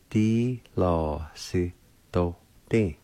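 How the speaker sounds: "Di lo si do din" said slowly and carefully; slow demo. Shown as a demonstration. Teacher repeating platform slowly for learners. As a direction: ease through "Di lo si do din" slowly. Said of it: "is pronounced like this".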